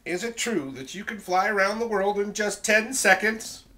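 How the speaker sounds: high, loud storybook question to a dragon; Elizabeth's eager question. Acted. Elizabeth asks the dragon to show off, eager and loud.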